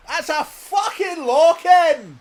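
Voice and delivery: furious high Scottish bellow; shouty Scottish. Acted. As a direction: shout it slowly, full blast, in Scottish. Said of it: "shouty Scottish people".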